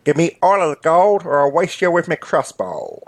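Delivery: put-on rough-accented robber making demands; inept bank robber. Robber holding up a bank clerk, comic menacing demand. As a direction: threaten like a comic small-time crook.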